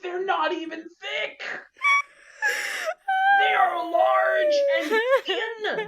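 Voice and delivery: high, laughing cry of protest. Real, not acted. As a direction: shout it out while cracking up.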